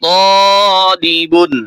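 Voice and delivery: তলিবুন said very slowly, the first vowel held one alif long; slow Quranic spelling blend; drawn-out blend. Shown as a demonstration. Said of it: "এক আলিফ করে টান দিয়ে পড়তেছি".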